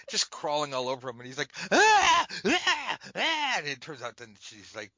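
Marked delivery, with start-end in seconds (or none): 1.7-3.5 loud high horrified yelps; horrified cries. Retold or imitated. [name] yelping as the stripper crawls on him. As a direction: squeal like a horrified pop star.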